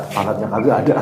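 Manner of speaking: a lightly voiced mock objector, laughing; a touch of a Betawi speaker, laughing; imitating someone. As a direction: quote him in a mock Betawi voice. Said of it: "Dia kata".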